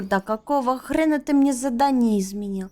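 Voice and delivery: ordinary talk, nothing marked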